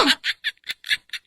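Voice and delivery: soft, sly mocking laugh. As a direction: laugh at her fear. Kidnapper mocks the worried mother. (mapang-uyam na tawa)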